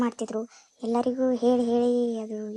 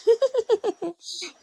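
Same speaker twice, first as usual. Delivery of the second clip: burst of laughter; 10 semitones higher, 4 dB louder than usual. Acted. Auntie's joke sets off laughter.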